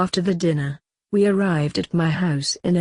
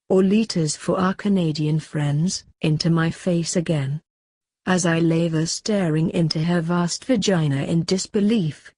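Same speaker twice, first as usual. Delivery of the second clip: usual speaking voice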